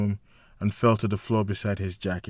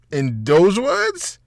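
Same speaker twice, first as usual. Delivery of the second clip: slow, much higher teasing question; amused disbelief; 10 semitones higher, 8 dB louder, much slower than usual. A narrator's reading. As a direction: draw it out in mock disbelief.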